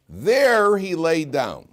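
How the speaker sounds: There drawn out high and loud, then falling; emphatic THERE. Shown as a demonstration. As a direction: lean hard on "There", slowly. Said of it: "every single inflection".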